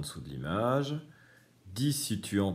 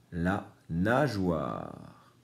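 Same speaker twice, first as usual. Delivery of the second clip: very slow clear reading; much slower than usual. Shown as a demonstration. Teacher to pupils spelling out a word, very slow. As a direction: stretch "La nageoire" out syllable by syllable. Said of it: "dans nageoire, j'entends wa".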